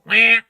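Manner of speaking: sudden loud mock cry of wah; mock wail. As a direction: blurt a loud babyish wah. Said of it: "keep a straight face and not go".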